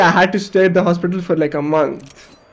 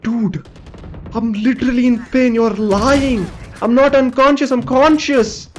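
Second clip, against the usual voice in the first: indignant protest; 6 semitones higher than usual. Retold or imitated. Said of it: "I'm like".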